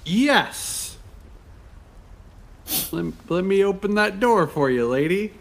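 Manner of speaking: gallant in-character reply. Acted. Pep answers vampire lady.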